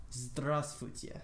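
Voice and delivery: Russian greeting said slowly for learners; pronunciation demo. Shown as a demonstration. Teacher showing viewers a Russian greeting, slow pronunciation demo. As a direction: sound out Здравствуйте slowly and carefully. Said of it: "it's pronounced like this".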